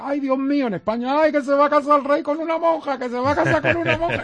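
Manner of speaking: high, scandalized mock gasp of the gossiping crowd. People in Spain fretting the king weds a nun, high mock alarm. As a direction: squeal it like a shocked churchgoer, half laughing. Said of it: "la gente decía".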